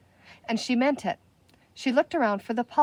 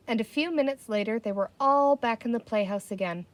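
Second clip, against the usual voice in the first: ordinary delivery, nothing marked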